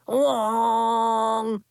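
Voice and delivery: loud blaring horn imitation; air horn noise. Air horn blast again.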